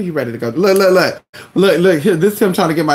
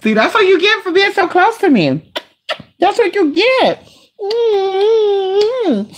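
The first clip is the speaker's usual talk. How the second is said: much higher cutesy voice to the dog; teasing pet voice; 12 semitones higher, slower than usual. Real, not acted. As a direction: tease the dog sweetly. Teasing the dog after hitting him.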